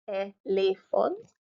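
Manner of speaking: "elephant" slowly as ay - lay - font; syllable by syllable. Shown as a demonstration. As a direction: separate the syllables of "elephant", rising. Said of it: "split your word into syllables".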